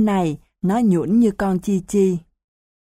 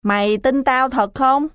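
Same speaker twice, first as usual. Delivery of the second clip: suspicious challenge; 6 semitones higher than usual. Acted. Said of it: "Lâm lừ mắt nhìn Quý Ròm".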